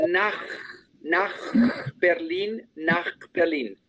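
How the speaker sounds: "nach" with a throaty, hissing ch like a Spanish J. Shown as a demonstration. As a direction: breathe "nach" out from the throat, no "tch". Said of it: "la C H se pronuncia como si fuera una G, una J". ("nach" con la ch gutural como una jota)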